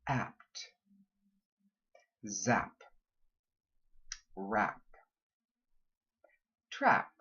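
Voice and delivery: each word said crisply with a short clean a; crisp model words, one at a time; model words for repeating. Shown as a demonstration. Accent coach drills a word list for students. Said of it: "repeat after me".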